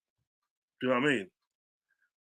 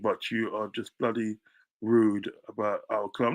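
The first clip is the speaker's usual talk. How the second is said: normal talking voice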